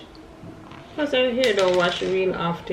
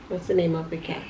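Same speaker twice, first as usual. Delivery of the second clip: ordinary talk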